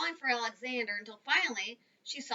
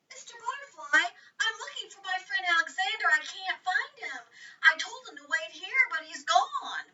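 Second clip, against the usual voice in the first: put-on higher girl's voice, asking for help; Jessie asking; 7 semitones higher, 5 dB louder than usual. A narrator's reading. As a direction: voice Jessie a bit higher, pleading. Jessie asks the butterfly.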